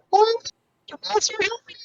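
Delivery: high strained put-on voice; weird news guy voice. To officer Messer, a high strained nerdy voice. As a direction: play a nerdy panicked reporter. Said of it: "I had this weird voice".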